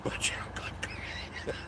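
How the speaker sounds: half-whispered tease through laughter; giggling, breathy tease; laughing whisper. Real, not acted. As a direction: whisper it while laughing at her. Teasing sister, laughing whisper.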